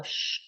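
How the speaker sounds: quiet long sh breath; soft shushing exhale. Shown as a demonstration. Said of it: "This time the liver is sh".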